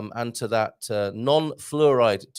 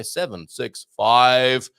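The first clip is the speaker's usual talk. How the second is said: loud launch countdown; mock countdown; 8 semitones higher, 6 dB louder, slower than usual. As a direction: call it like mission control. Thunderbirds countdown, rocket launch.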